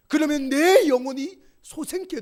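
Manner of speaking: loud, high, fervent pulpit preaching; full-voiced sermon climax; preaching at full force. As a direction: drive each line home to the congregation.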